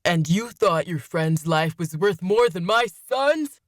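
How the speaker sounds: slow, enraged roar. A narrator's reading. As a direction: roar it in outrage, word by word. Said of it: "Malcolm roared".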